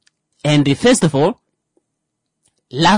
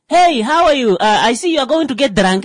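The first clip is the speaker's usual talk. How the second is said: mock cheerful blunt greeting; 10 semitones higher, 5 dB louder than usual.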